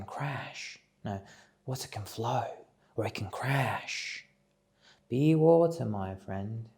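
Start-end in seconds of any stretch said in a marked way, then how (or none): 1.6-6.7 mock master's wisdom, pitched up at the start then dropping; horrible Bruce Lee impression. Retrying Bruce Lee for listeners, bad hammed-up impression. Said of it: "That's a horrible impression of Bruce Lee".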